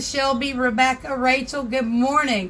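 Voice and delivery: ordinary talk, nothing marked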